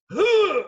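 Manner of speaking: loud, high mock "ugh"; imitated impact grunt. Cop smashes into a filing cabinet, imitated loud grunt. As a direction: yelp out the hit, exaggerated. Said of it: "the sound he made, just like".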